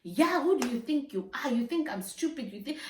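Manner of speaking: hammed-up accusing questions; retold outburst. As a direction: imitate a man performing, indignant questions. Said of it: "he perform".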